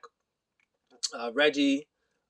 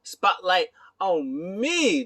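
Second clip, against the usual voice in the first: slow, high, stretched-out proclamation; hammed-up diva demand; mock showboating; 6 semitones higher, 4 dB louder, much slower than usual. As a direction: draw it out like a self-adoring star. Acting out Rollins demanding attention.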